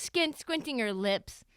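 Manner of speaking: ordinary delivery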